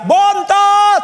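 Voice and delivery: high, loud calling shout; mother's yell. Retold or imitated. Mother calling her youngest child, yelling. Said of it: "Kalau mama saya teriak".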